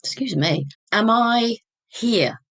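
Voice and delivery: fighting back tears as the memory returns; real emotion breaking through. Real, not acted. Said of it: "I'm getting quite emotional now".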